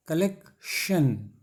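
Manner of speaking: कलेक्शन very slow, break before SHAN; pronunciation demonstration. Shown as a demonstration. Teacher isolating the last syllable of कलेक्शन, slow split delivery. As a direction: say कलेक्शन as KALEK, pause, SHAN. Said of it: "कर देते हैं बोलने में".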